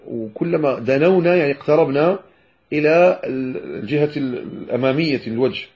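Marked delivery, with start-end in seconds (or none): none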